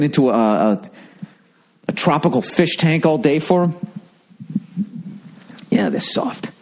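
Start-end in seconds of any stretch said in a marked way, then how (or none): 5.7-6.5 whispered aside. Real, not acted. Whispered contempt.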